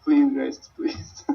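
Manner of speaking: high, playful begging; mock pleading. Real, not acted. Co-host jokingly begs viewers for donations, mock pleading.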